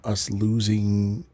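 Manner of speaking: usual speaking voice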